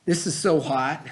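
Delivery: plain reading voice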